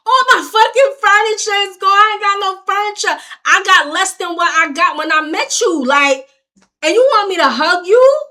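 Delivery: high, loud furious scream; screaming at the ex about lost furniture. Retold or imitated. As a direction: peak of the fight. Peak of yelling at ex.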